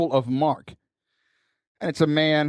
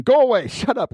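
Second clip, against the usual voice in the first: mock shooing; 6 semitones higher, 4 dB louder than usual. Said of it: "the people who were saying".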